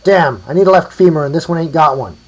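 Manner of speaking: loud, high holler down a hill; called out. A narrator's reading. A grave robber hollers for a left femur, calling out. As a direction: shout it to carry over distance. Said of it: "called out from down the hill".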